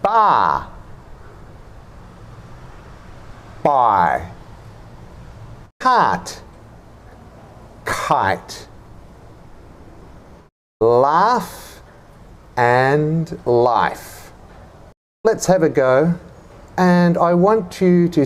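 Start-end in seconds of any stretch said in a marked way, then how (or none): 0.0-4.3 "Bar" open, "buy" gliding, said apart; teacher models a word pair, "buy" lower; minimal pair demo. Shown as a demonstration. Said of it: "Repeat after me". 5.8-8.7 careful teacher demo of a word pair; minimal pair demo. Shown as a demonstration. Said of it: "Repeat after me". 10.8-14.3 "Laugh" with an open ah, "life" with a glide; teacher models vowel contrast; minimal pair demo. Shown as a demonstration. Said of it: "Repeat after me".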